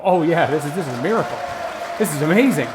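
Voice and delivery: lightly mocked cheerleader gushing; mock rapture over your own draft; a touch of overblown self-congratulation. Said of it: "If the cheerleader voice is dominating".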